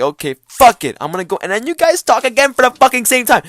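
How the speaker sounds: high, loud comic outburst; exasperated shouting. Retold or imitated. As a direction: yell it out in mounting exasperation. Exasperated at talking over each other again.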